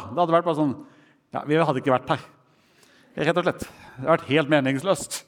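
ordinary delivery

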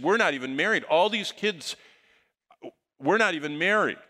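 a mock-anguished cry of confession; distraught outburst.

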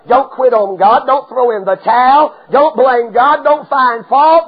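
ordinary delivery

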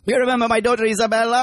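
mock Italian dad, quick; fake Italian accent. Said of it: "fake English accent doing a fake Italian accent".

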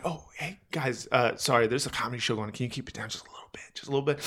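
fast, soft whisper asking them to keep it down; hushed polite request. Retold or imitated. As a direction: politely shush them so the show goes on. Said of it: "I'm like".

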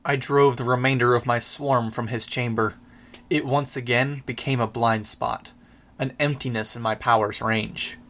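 usual speaking voice

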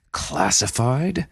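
slow, outraged disbelief; angry. Acted. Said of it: "flushed with anger".

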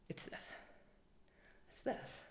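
breathy whispered reveal. Real, not acted. To her listeners revealing the answer, whispered. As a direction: drop to a whisper, almost secret.

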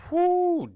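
high, drawn-out whew; long loud whew. Real, not acted. Exclaims at devastating loss.

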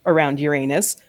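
usual speaking voice

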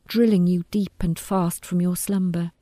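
ordinary delivery, nothing marked

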